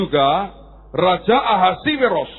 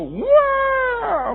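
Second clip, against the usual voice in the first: put-on high child wail; mock a child's screaming tantrum; anak merengek. Anak muntah sengaja. (tangisan anak yang dibuat-buat, melengking tinggi)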